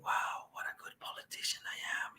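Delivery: breathy self-satisfied murmur, barely audible; smug whisper. Retold or imitated. Whispered smugness of a politician in bed.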